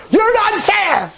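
high, slow, laughing mock whine. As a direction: whine it through laughter like a sulking child. Retold complaint to God, laughing.

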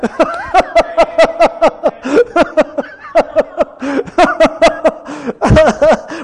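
drawn-out real laughter; long laugh. Real, not acted. Preacher laughing at his own family story, long laugh. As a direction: keep laughing through it.

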